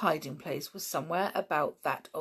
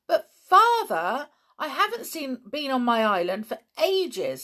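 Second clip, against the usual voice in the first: high, loud protest to a father; indignant; 8 semitones higher, 8 dB louder than usual. A narrator's reading. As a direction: protest indignantly, leaning hard on the words. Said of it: "said George indignantly".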